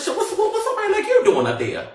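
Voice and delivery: high, squeaky disbelief, laughing; incredulous officer. Retold or imitated. The officer asking why someone like her was there, high and incredulous. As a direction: screech the question in disbelief. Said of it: "She said".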